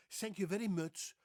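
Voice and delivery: put-on loud, high Dutch-accented thanks; exaggerated Dutch accent, th turned to s. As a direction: Dutch English with sharpened vowels. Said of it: "They say thank you".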